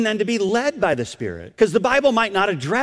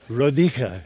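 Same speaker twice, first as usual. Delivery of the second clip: low, imitated Romanian accent; 6 semitones lower than usual. Grandmother repeating her name on the street, imitated Romanian accent. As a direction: say the name lower, as a Romanian speaker would. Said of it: "she says in this unique accent".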